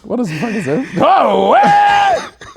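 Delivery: high, shouted what; mock shock. Pretending to find porn tabs, shouted mock surprise. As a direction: gasp it out in fake shock.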